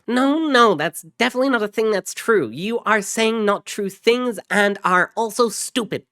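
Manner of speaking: loud, very high, rapid contradiction; mocking high voice. Ruiz mocking his brother over the radio, high and loud. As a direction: squeal it in mock correction.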